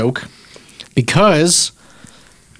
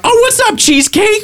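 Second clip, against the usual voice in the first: waitress greeting. Retold or imitated. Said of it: "And then she was like".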